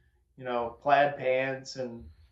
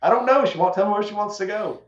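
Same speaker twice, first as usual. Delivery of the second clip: higher, louder helpless "I don't know"; exasperated clueless reply; 6 semitones higher, 4 dB louder than usual. Retold or imitated. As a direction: replay his baffled protest, pitched up.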